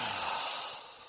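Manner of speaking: long audible sigh; deep breath out. Shown as a demonstration. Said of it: "start with a couple of deep breaths".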